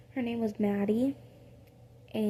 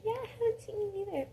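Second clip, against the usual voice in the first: higher, sweeter and softer; friendlier high retake; 10 semitones higher, 5 dB quieter, faster than usual. Acted. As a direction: say it nicely this time. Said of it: "That sounded so rude".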